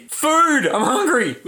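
high, exploding cry; sneezed outburst. Pretend. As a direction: blurt it out in a comic burst.

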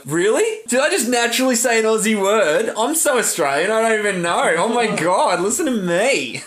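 mock astonishment. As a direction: act out being amazed by your own Aussie-ness. Retelling own astonished reaction.

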